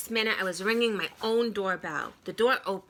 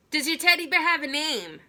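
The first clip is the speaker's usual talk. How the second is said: asking louder; 8 semitones higher, 5 dB louder, faster than usual. A narrator's reading. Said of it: "I said louder".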